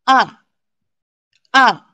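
short "A" falling sharply from high; fourth tone. Shown as a demonstration. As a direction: drop "A" quickly from the top. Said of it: "Falling tone".

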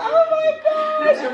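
squealing oh my gosh; overjoyed gasp. Real, not acted. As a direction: squeal it out in delight. Overwhelmed by surprise gift.

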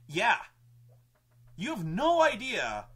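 loud, high, drawn-out comeback; emphatic retort. Retold or imitated. Game master answering his shocked players, emphatic retort.

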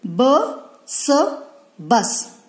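ब and स each alone, then blended as बस; letter by letter. Shown as a demonstration. Teacher correcting a whole-word reading, letter by letter. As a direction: sound out ब, pause, स, pause, then बस. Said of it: "बस नहीं".

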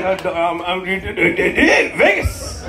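mock stammer, dragged out; mock hesitation. Comic mimicking an audience member dodging where he's from, mock hesitant. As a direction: stumble hesitantly toward the answer, imitating him. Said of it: "He's like".

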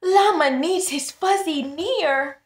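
high, loud wailing sob; mock crying. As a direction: wail it like a little one crying for his toy. Llama crying for his Fuzzy.

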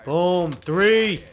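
loud, rising cheer on each kill; hyped kill count. Real, not acted. Gamer calling out his kill streak loudly. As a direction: count the kills out in triumph.

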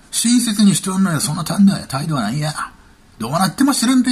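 mock Kansai dialect. Kansai thug threatening his target, mock intimidation. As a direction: put on a pushy Kansai thug accent. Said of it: "関西弁で言われたんでしょ？".

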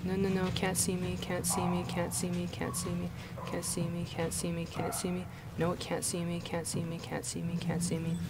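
hushed nervous chanting; being chased. Real, not acted. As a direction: murmur it softly, hoping to hide. Hiding from creature, chanting softly.

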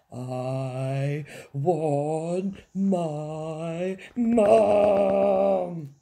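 slow wail swelling into a bawl. A narrator's reading. Princess playing with the maid, bawling for Mum. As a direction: drag out each word, blast Mum. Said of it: "bawled the little princess".

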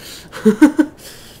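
short, loud high-pitched laugh; quick loud cackle of amusement. Real, not acted. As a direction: burst into a high giggle. Laughs at flying upside down.

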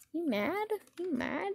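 soft, high, rising taunt; teasing question. Pretend. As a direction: tease lightly, lifting the end. Teasing the cat.

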